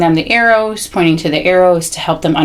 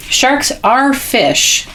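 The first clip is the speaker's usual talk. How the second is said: slow, higher, with "are" drawn out; stress on "are"; 8 semitones higher, much slower than usual. Shown as a demonstration. Parent reading shark book aloud to child, stressing "are". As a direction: lean hard and high on "are". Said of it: "I emphasize the word are".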